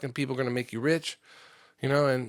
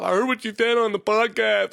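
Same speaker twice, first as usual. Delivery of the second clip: higher, louder mocking put-on voice; pissed-off apprentice; 7 semitones higher, 5 dB louder than usual. Apprentice confronting the host about the podcast, mock pissed-off. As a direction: mimic an offended coworker confronting you. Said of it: "get all pissed off".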